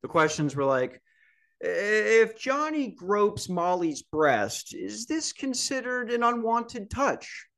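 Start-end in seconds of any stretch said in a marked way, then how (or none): 1.6-7.5 mocking quiz reading. Mocking the orientation quiz question.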